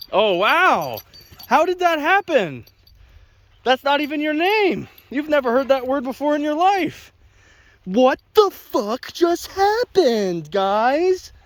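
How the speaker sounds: high-pitched, hammed-up astonishment; mock amazement. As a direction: act wildly amazed, stretching the words out. Feigns amazement that dog came.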